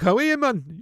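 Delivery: lightly imitated Geordie greeting; Geordie accent. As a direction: put on a Geordie accent for the coach. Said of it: "He's a Geordie".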